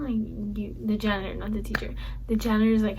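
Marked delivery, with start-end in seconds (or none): none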